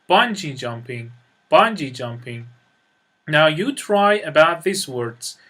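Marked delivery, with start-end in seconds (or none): 0.1-2.4 bungee jumping stressed on bungee; stress on first part. Shown as a demonstration. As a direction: lead with bungee, jumping light. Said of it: "the stress is on the first syllable".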